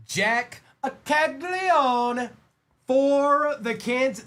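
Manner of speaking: high, loud drawn-out announcement; excited hit call. Real, not acted. Card breaker announces a big pull to viewers, excited. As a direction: belt out the player's name, stretched and high.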